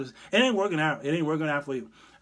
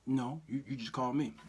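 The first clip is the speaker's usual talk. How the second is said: hushed Jesus; 4 semitones lower, 8 dB quieter than usual. Pretend. Jesus on the phone.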